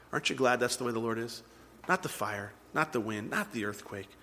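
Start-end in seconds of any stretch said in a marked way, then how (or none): 1.9-4.1 soft, low listing; hushed. Real, not acted. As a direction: drop low and soft, fading further. Contrasting storm with still small voice.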